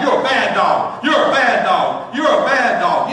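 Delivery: mock scold, hammering each you're; scolding a dog.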